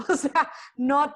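loud, high burst of laughter; real laugh. Real, not acted. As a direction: laugh it out, then speak through it. Laughing at own joke. (risa fuerte y aguda)

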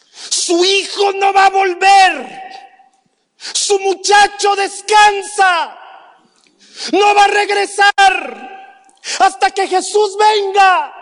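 high, loud and slow declaration; forceful preaching shout. Real, not acted. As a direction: hammer the hard truth home. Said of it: "Míreme, por favor". (proclamación a gritos, lenta y firme)

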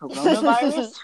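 loud, high, questioning taunt; mocking retort. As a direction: throw it back as a mocking question. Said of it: "be like".

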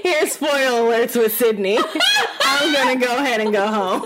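teasing announcement broken up by laughter; cracking up. Real, not acted. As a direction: laugh helplessly as you tease. Cracking up at co-host's spoiler.

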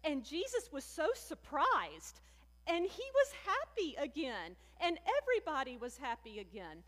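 imitating a little girl's delighted song ending; gleefully retelling the niece's happy ending; child's excited sing-song. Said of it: "she said".

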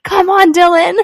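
put-on high, loud toddler cheering. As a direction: call it out like a two-year-old. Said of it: "He's, like, two years old".

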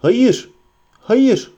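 high, loud cry of refusal; Frodo shouts. A narrator's reading. Frodo refusing Boromir's demand, crying out. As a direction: fling out a frightened no. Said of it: "diye bağırdı Frodo".

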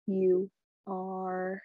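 normal talking voice

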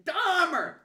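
high, loud shouted name; yelling heckle. Retold or imitated. Rival fan calling out a heckle, yelling. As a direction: holler it across the stadium. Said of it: "he starts yelling at me".

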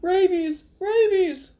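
loud, high frantic shout; panicked cry. A narrator's reading. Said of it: "before Fezzik cried".

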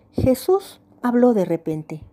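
usual speaking voice

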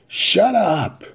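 high, loud shout; violent interruption. Acted. Biff's brother is cut off mid-excuse, violent shout. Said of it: "cutting him off violently".

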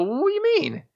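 high-pitched, baffled squeak; young office kid baffled by an order to dump the liquor; startled question. Retold or imitated. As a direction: shoot up in disbelief. Young him hears boss's order.

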